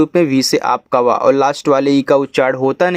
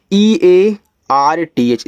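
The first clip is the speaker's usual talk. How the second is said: slow, letter by letter; spelling out; 2 semitones higher, much slower than usual. Shown as a demonstration. Teacher spelling a word for beginners, slow spelling out. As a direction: spell it out slowly for learners.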